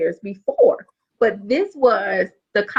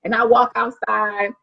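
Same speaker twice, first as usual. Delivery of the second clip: normal talking voice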